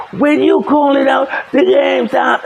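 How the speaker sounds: high-pitched indignant accusation, acted out; worked-up retold protest; retold angry complaint. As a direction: imitate an opponent arguing a call. Acts out opponent yelling at him.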